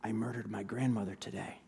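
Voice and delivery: low, hushed murmur. A narrator's reading. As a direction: murmur it softly under the breath. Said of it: "he murmured".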